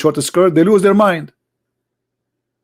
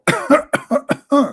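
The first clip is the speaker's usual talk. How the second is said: high strained forced cough; fake cough; 9 semitones higher, 3 dB quieter than usual. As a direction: cough hard on purpose. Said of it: "I will cough more".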